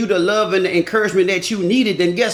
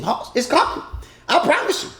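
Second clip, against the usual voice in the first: ordinary talk, nothing marked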